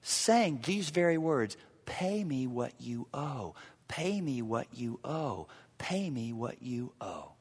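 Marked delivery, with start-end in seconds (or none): 1.8-7.3 cold, soft whisper of the demand; hushed whisper of the unforgiving servant. Acted. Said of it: "picture it".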